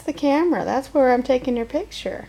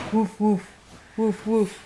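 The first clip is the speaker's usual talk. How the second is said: low, soft woofs; short, low dog barks; dog woofing; 5 semitones lower, 3 dB quieter, slower than usual. Acted. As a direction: imitate a doggy for a toddler. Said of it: "What does the doggy say".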